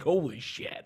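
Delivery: hushed, awed mock gasp of disbelief; a quiet, stunned imitation of the host; awed Conan. Host watching the roundhouse kicks, imitated in a quiet awe. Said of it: "Conan's like".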